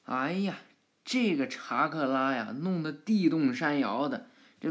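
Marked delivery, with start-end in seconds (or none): none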